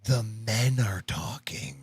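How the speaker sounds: slow, patronizing stage whisper; mock hushing whisper. Host pretending to hush a woman witness, mock whisper. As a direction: whisper it slowly, as a mock shushing.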